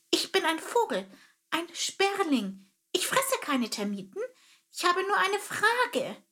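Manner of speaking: high eager sparrow voice; a small bird earnestly explaining; little sparrow voice. Acted. Sparrow Chilp explains himself.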